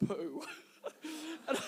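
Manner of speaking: tearful real laughter through the words; struggling to speak through giggles; laughing and crying. Real, not acted. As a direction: crack up while delivering the line. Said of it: "now I'm both laughing and crying".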